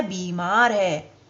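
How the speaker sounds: बीमार said slowly with a long बी; slow careful बीमार; correct pronunciation. Shown as a demonstration. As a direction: stretch बीमार on the बी. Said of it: "सही शब्द उसका क्या है?".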